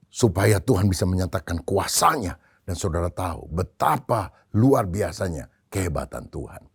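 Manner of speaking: ordinary talk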